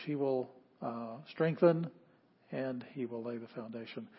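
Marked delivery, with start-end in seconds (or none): none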